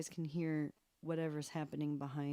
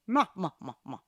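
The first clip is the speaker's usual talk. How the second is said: laughing through silly syllables; playful laughing babble that fades; giggling; 3 semitones lower, 3 dB louder, much faster than usual. Real, not acted. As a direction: giggle out the syllables, fading away. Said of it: "I'm still laughing".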